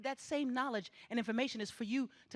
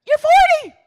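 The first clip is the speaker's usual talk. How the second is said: much higher, loud punch on forty; shouted warning; 22 dB louder than usual. Real, not acted. Speaker warning graduates they'll soon be forty, shouting. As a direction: hit them with it at full voice. Warning youth time flies.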